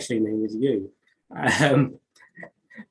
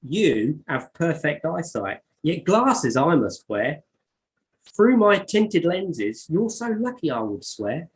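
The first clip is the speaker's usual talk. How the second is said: put-on higher, dramatic poem reading in her own accent; 8 semitones higher, 5 dB louder, slower than usual. Reading her verse back to the guest, higher and dramatic. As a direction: perform the verse with relish, pitch up.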